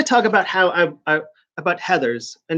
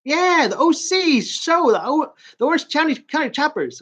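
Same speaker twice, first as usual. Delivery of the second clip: retold cleaning guy; 10 semitones higher than usual. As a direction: imitate a chatty guy sharing news, pitched up.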